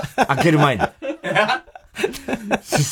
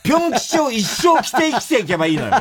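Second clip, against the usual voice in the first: mock boss voice; 13 semitones higher than usual. The lady boss's ultimatum, a high hammed-up voice. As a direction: overplay it like a wounded wife.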